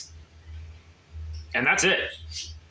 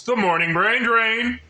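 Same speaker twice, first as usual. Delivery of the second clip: ordinary talk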